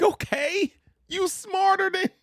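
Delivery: very high squeaky praise; mock impressed squeal. Teasing Carrie with a high squeaky compliment. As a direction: squeak it up in playful awe. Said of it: "I'm like".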